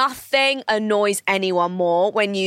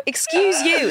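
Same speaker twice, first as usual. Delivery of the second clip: higher, pointed retort; retold sassy comeback; 7 semitones higher, slower than usual. Teller answering back to a rude stranger, pointedly. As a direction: throw it back at her, pitched up. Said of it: "And I go".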